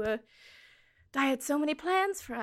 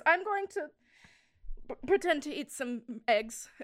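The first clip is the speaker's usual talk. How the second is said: teary; 4 semitones higher than usual. Acted. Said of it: "turns away and goes".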